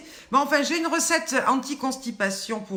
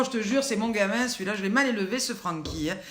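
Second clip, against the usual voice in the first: ordinary talk, nothing marked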